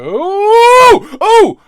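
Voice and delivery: high, loud excited ooh; excited squeal. Real, not acted. To the viewers, realizing a mask would hide the sores, an excited squeal. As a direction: squeal with real sudden excitement. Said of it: "I got excited about nothing there".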